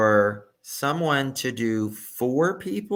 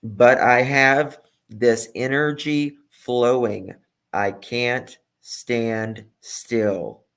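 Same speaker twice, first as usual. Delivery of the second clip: drawn out, word by word; slow, emphatic quote; 4 dB louder, slower than usual. Retold or imitated. Commentator quoting the suspect for viewers, slow and emphatic. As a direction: stretch each phrase with heavy pauses.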